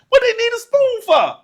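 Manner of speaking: very high, incredulous question; baffled kid. Retold or imitated. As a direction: squeak it out like a bewildered child. Child baffled by spoon request.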